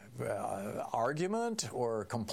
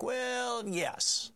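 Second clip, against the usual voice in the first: high, drawn-out Trump voice on the well; mocking Trump's evasive stall; Trump impression; 13 semitones higher than usual. Host mocking Trump's stalling answer to reporters, high and drawn-out. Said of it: "And Trump was like".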